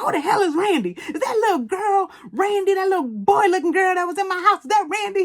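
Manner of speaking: imitating an outraged mama, high-pitched; high, mock-outraged mother on the phone; indignant mama. Mama snaps at caller on phone.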